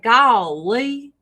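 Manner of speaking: mock Southern "Golly", stretched out; lilting little Southern twang; Louisville twang. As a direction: imitate a light Kentucky twang. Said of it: "someone from Louisville who's just like".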